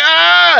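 high startled yelp; shocked yelp. Retold or imitated. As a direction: let out a sudden shocked "ah". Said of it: "and I go".

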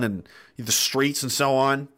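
normal talking voice

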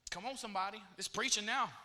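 normal talking voice

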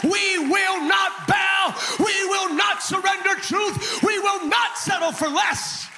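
NOT hit high and slow; stress on NOT. Acted. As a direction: punch not, drag out each word. Generation's declaration, shouted slowly.

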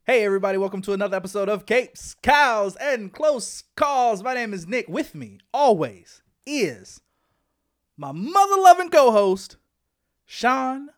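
upbeat announcer intro, drawn-out title; show host intro. Acted.

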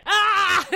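sudden high loud yelp of pain; pinched howl. Acted. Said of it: "I howled".

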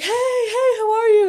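high, chirpy hey hey greeting; friendly stranger. Retold or imitated. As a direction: bounce up like an overfriendly stranger.

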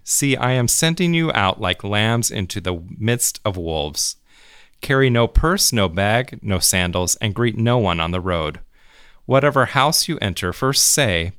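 normal talking voice

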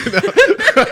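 high, loud laugh through the words; cracking up at her own joke; squealing with laughter. Real, not acted.

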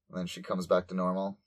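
usual speaking voice

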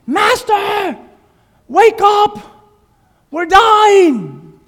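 high, loud panicked shout; panicked disciples. Acted. Disciples in the storm shouting to wake Jesus, terrified. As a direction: scream for help as the boat sinks. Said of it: "pretend he's here laying down".

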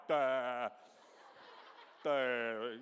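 low, dopey "duh" with a blank stare in the voice; dim-witted horse. Retold or imitated. Preacher voicing a dumb horse in the chaos, dopey and low. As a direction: play a clueless horse gawking around. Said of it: "Matthew back there just like".

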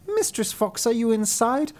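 a cat maid calling her mistress. Acted. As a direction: call up through the door sweetly. Cat calls upstairs.

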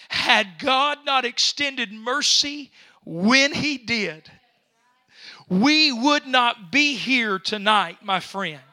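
slow, emphatic sermon delivery; drawn-out pulpit oratory; impassioned preaching. Real, not acted. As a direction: preach it slowly with rising force. Preaches at rising force.

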